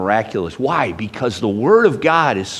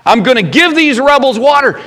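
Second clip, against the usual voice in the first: mock angry God; 9 semitones higher, 5 dB louder than usual. Pastor mocking an angry God he never was, indignant. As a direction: spit out rebels with scorn. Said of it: "God did not say to Moses on this occasion".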